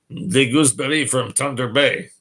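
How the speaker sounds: put-on playful Canadian French accent. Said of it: "I love Canadian French accents".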